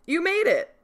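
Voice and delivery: game master setting the scene; story narration. Acted. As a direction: draw them into the haunted house. GM reads opening scene.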